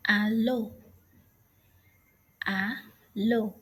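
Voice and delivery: Hallo with no H sound, as "a-lo"; silent H. Shown as a demonstration. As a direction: drop the H at the start of Hallo. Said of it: "The H sound is not pronounced".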